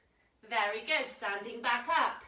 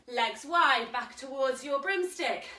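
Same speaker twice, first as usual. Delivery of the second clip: normal talking voice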